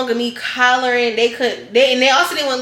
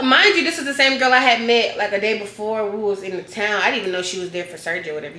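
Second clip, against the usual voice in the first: ordinary talk, nothing marked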